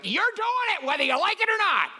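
high, put-on bossy voice; mock forceful Jesus. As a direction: play Jesus as a pushy boss, mocking. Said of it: "Jesus wasn't gonna be like".

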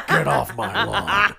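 slow, drawn-out growling threat; Clint Eastwood impression. Grandfather warning kids like in Gran Torino, slow impression. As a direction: imitate a menacing movie old man, unhurried. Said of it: "Clint Eastwood".

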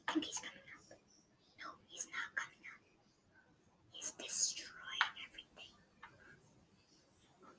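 tense hushed whisper; whispering while hiding. Real, not acted. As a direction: whisper it, barely breathing. Hiding from enemy in game.

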